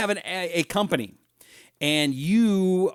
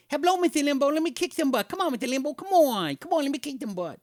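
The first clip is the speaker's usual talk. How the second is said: much higher, lisping boxer voice; Mike Tyson impression; 12 semitones higher than usual. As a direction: do a high, lisping heavyweight taunting a host. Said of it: "I used to do, uh, Mike Tyson".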